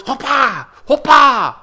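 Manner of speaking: high, explosive playful shout; mock fight cries. Vlogger acting out throwing elbows, loud playful fight cries. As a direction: yell it like landing an elbow. Mimicking elbow strikes in his boast.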